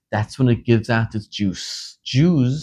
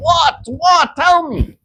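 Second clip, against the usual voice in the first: frantic demand; 4 dB louder, slower than usual. Retold or imitated. King panics, demands to know.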